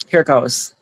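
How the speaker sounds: ordinary delivery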